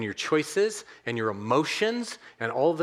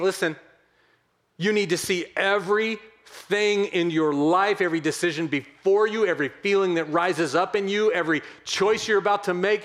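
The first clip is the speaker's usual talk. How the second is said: raised, driving sermon delivery; impassioned preaching; 4 semitones higher than usual. Real, not acted. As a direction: urge the congregation with emphatic force. Preaches the main challenge intensely.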